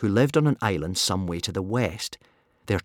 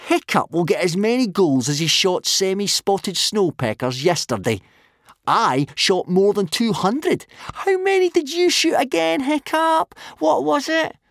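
mocking, climbing to a high squeal; scornful taunt that gets higher as it goes; jeering; 11 semitones higher, 4 dB louder than usual. A narrator's reading. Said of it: "jeered Snotlout".

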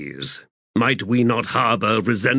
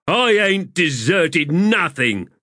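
shout; 8 semitones higher, 3 dB louder, slower than usual. A narrator's reading. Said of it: "Stormy shouted".

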